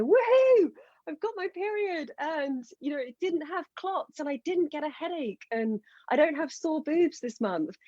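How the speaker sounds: high, celebratory client voice starting on a big whoop; excited clients. Retold or imitated. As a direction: imitate a client cheering over good news. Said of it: "they get really excited".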